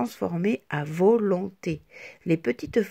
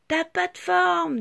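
higher, louder taunt; taunting child; 8 semitones higher, 4 dB louder than usual. A narrator's reading. Petit Triangle mocks Petite Tache, higher sneering taunt. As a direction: jab it at her. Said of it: "dit Petit Triangle".

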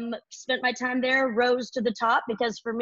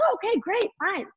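eager naive agreement; 10 semitones higher than usual. Retold or imitated.

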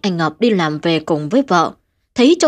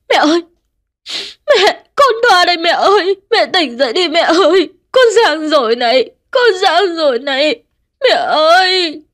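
sobbing lament; 9 semitones higher, 5 dB louder than usual. Acted. Daughter sobbing over her dead mother's body. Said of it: "mà mếu máo".

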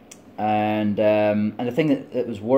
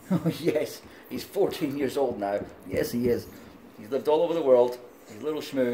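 high cooing pet voice; 3 semitones higher than usual. Real, not acted. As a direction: coo at a beloved pet. Cooing over his pet.

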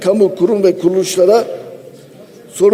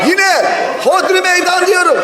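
defiant shout; 9 semitones higher than usual. Real, not acted. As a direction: throw down the gauntlet at full voice. Heated protest at the podium. (meydan okurcasına bağırarak)

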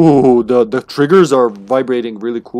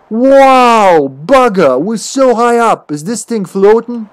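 put-on a loose British accent, a bit louder; British character voice; 6 semitones higher, 5 dB louder than usual. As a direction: play a brash Brit character, lightly done. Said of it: "I can't do her good voice".

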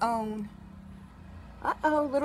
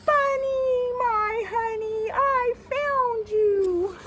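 high, drawn-out joyful call; long, high singsong reunion call; mommy rabbit calling; 9 semitones higher, slower than usual. A narrator's reading. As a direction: sing out that you found your little one. Mommy finds her lost bunny.

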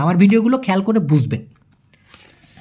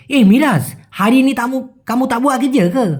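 higher-pitched Malaysian slang with drawn-out endings; Malaysian slang drawl; 4 semitones higher than usual. Retold or imitated. Co-worker questioning Miraj, in drawling Malaysian slang. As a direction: call out in slurred Malaysian slang. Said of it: "স্ল্যাংয়ে টান দিয়ে বলে".

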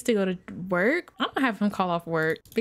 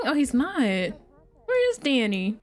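normal talking voice

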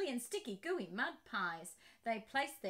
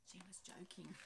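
whispered aside; 5 semitones lower, 15 dB quieter than usual. Acted. Reading aloud aside.

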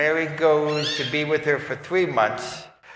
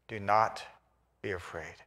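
soft reassurance; 5 semitones lower, 10 dB quieter than usual. Acted. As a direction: lay it down softly like a comfort. Comforting after a sigh.

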